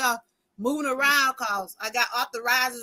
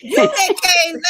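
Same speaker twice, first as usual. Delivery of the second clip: teasing through a laugh, louder; laughing tease; 5 semitones higher, 7 dB louder than usual. Real, not acted. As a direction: laugh while you call her out. Teasing a late guest.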